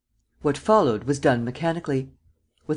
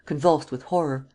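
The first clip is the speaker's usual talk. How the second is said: usual speaking voice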